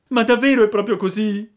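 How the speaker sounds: high, loud, fast tearful plea; a hint of sobbing. A narrator's reading. Pinocchio asks through tears, a touch of sobbing. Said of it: "domandò singhiozzando il burattino".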